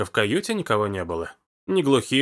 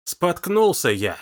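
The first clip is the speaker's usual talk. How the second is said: slow, pointed, higher and pressed; angry repeat; 6 semitones higher, slower than usual. A narrator's reading. Engar, furious, repeating it into Hugo's face. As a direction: spit it back word by word in anger. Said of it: "Он приблизил злое лицо ... и повторил".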